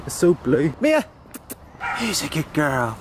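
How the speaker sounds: ordinary talk, nothing marked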